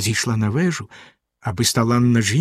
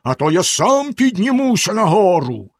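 Bluebeard bellowing; 7 semitones higher than usual. A narrator's reading. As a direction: roar the order up the stairs. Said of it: "знову заволав синя борода".

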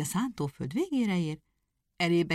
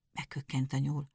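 plain reading voice

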